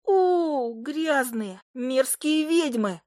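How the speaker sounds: high, drawn-out creature moan; woeful little familiar. A narrator's reading. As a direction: wail the insult in a put-on high voice. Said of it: "простонала Фая".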